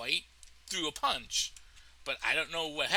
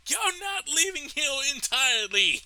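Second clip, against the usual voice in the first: high, loud, strained yell; hammed-up fighter's threat at full volume; mock battle cry; 13 semitones higher, 8 dB louder than usual. Acting his battle cry.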